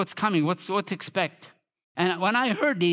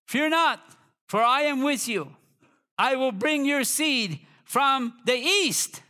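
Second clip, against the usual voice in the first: high, ringing declaration of scripture; preacher calling out a Bible verse; scripture proclamation; 8 semitones higher, slower than usual. Acted. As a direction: proclaim it, stretching the final words.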